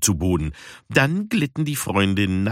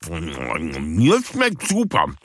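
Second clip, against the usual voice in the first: very slow, mouth full of fish; smacking lips; 3 semitones lower, much slower than usual. Acted. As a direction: talk through a mouthful. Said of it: "schmatzte Puma". (schmatzend, mit vollem Mund)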